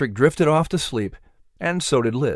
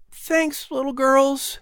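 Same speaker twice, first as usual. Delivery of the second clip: broken and exhausted; 13 semitones higher, slower than usual. A narrator's reading. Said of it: "His voice was broken and exhausted".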